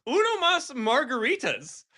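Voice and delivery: high, drawn-out American-accented Spanish, hammed up.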